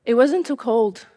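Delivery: ordinary delivery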